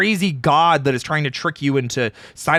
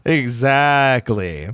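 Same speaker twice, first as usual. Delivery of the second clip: villain voice; 4 dB louder, much slower than usual. Pretend. Playful villain voice reply.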